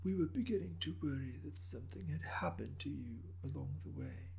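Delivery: put-on soft, high, raspy elderly voice; an old governor gently scolding a late guest; raspy ancient governor. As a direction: rasp it out like a frail old lord. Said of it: "intoned in a raspy voice".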